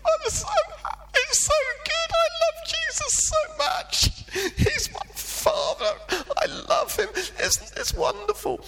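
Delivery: very high, laughing, half-sung ecstatic gush; giddy sing-song rapture with a laugh; retold joy. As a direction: bubble over like a boy who can't contain it. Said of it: "and I was".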